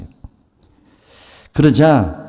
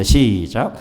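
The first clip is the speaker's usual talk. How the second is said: usual speaking voice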